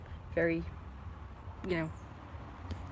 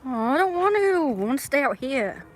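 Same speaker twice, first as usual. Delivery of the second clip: whiny refusal; 8 semitones higher, 6 dB louder than usual. Real, not acted. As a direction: whine loudly in protest. Reluctant to come inside.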